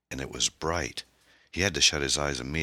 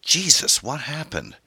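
loud, high-pitched alarmed outburst; sudden panic; 13 semitones higher, 7 dB louder than usual. A narrator's reading. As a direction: burst out in panic, then demand an answer. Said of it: "causing him to suddenly panic".

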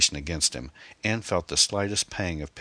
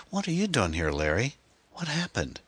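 put-on a much higher girl's voice, puzzled; teen girl voice; 7 semitones higher than usual. Amy walks in on the scene, high puzzled girl voice. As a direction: voice the teenage daughter, high and bewildered. Amy shocked to see Larry.

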